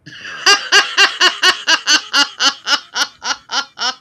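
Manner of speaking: loud high burst of laughter; long loud laughing fit; big laugh. Real, not acted. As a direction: crack up at the joke. Reacts to the wank joke.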